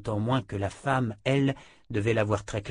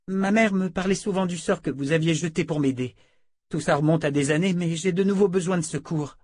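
put-on higher, earnest young woman's voice; appealing young woman; 8 semitones higher, 4 dB louder than usual. A narrator's reading. Jensen tries another approach with the sorceress, appealing. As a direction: appeal for help in a raised pitch.

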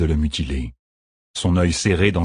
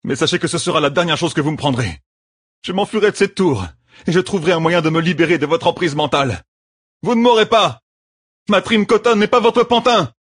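higher and faster, rising to loud defiance; defiant threat building to shout; 11 semitones higher, 3 dB louder than usual. A narrator's reading. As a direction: spit the threat, climbing to a yell.

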